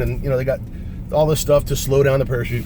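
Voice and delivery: plain reading voice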